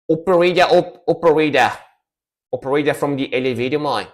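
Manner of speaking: playful Aussie voice, quick at the end; mock Australian accent. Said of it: "when I do the Australian accent".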